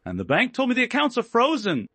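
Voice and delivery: high, loud frantic wail; shrill panic. A narrator's reading. Elena wailing to Julian about her cards, shrill panic. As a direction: squeal it in spoiled panic. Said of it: "her voice shrill and echoing painfully".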